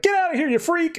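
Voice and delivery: high-pitched mock shout at the hero; mock angry yell. Civilians shouting at the Flash to get lost, mock yell. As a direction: squawk it out like a scared bystander.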